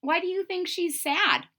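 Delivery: plain reading voice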